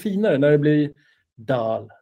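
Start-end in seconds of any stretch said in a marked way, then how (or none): 1.4-1.9 low "Dal" with the end drawn out; Dal, stretched and low. Shown as a demonstration. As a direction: linger on the last sound of Dal. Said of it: "man får dra ut lite på sista".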